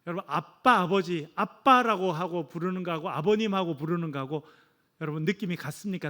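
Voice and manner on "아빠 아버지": ordinary talk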